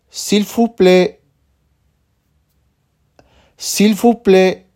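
"S'il vous plaît" slow, stress on "vous", falling; teacher drawing out "S'il vous plaît"; slow model phrase. Shown as a demonstration. Teacher to student, slow careful model. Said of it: "Ahora escucha y repite".

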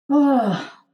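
high open release sigh; audible sigh. Real, not acted. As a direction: let out a long relieved sigh. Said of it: "You hear me sighing?".

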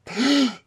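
sharp audible gasp; startled gasp. Acted. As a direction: gasp like someone caught hiding. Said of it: "you heard a gasp".

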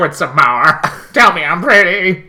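high, loud, fishing for compliments; mock vain preening. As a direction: beg to be called pretty, hammed up. Camp mock bit.

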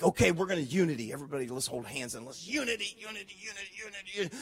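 mock trying to force unity; mock forced unity. Pastor mocking people who try to force unity. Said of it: "You're like".

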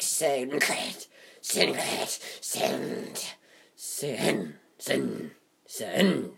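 "sand" said with a scratchy catch, soft. Shown as a demonstration. Vlogger showing sand's itchy texture, "sand" said scratchy and quiet. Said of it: "like, with a scratch".

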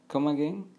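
"come again" run together as "kuh-muh-GEN", rising; correct pronunciation. Shown as a demonstration. Teacher showing learners how it is pronounced, linked pronunciation. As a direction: link "come again" into one questioning phrase. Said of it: "Se pronuncia".

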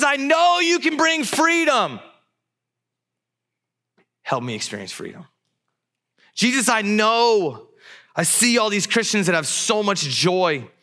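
desperate prayer. Acted. Praying to Jesus for healing and freedom, desperate. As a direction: plead in bursts, crying out then sinking back. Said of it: "That's the desperation that this dad has".

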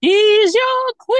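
slow, loud teasing. Real, not acted. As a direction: draw it out to tease.

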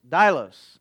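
dailos said as TIE-lohs, stress on the first syllable; Greek pronunciation. Shown as a demonstration. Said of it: "in Greek is dailos".

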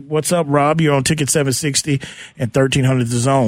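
ordinary talk

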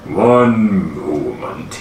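low, very slow giant voice; slow-speaking giant. Acted. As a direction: drag each word out deep and ponderous. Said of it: "he speaks pretty slowly".

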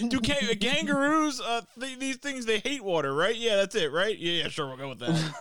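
high, loud, unsure guessing; a pretend fireman bluffing his way; mock unsure firefighter. Fireman guessing that kangaroos hate water, high and unsure.

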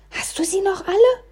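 high-pitched, incredulous scolding; indignant hiss. A narrator's reading. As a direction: scold a friend in a high, strained voice. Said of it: "zischte Oskar hinter mir". (empört gezischt, mit hoher Stimme)

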